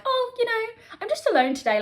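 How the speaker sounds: sing-song high "oh, you know", shrugging it off; mock breezy deflection. To her therapist, brushing off being alone with a breezy tone. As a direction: pretend it's no big deal, high and airy. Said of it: "where I'm like".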